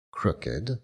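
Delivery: ordinary delivery, nothing marked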